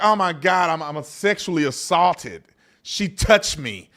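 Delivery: slow, hammed-up shocked complaint; mock offended victim. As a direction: parody someone making a fuss. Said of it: "I wouldn't be like".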